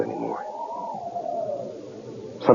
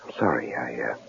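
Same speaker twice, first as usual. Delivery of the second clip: hushed apology at night; soft whisper; 5 semitones lower, 9 dB quieter than usual. Acted. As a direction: whisper so as not to wake her. Night, wife just woke.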